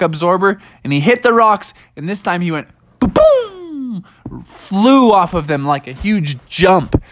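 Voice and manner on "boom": sharp high "boom" sound effect. Acted. Narrator sounding the mattress hitting rocks with a high boom. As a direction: make the crash a cartoon boom.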